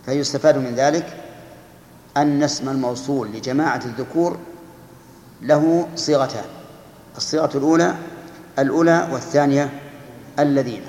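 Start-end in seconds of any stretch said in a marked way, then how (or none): none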